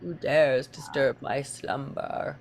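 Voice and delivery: slow, booming beast awakening; ancient monster voice. Pretend. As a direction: rumble it like a woken giant. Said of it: "I actually do that pretty good with this voice".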